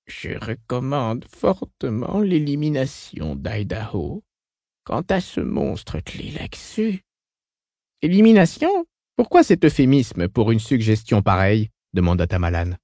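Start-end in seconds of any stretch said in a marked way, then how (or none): 0.1-7.0 slow, soft, guttural near-whisper; small hissing voice. Acted. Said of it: "d'une petite voix sifflante, à peine plus qu'un chuchotement guttural". 8.0-11.7 indignant. Acted. Tamalan objects sharply.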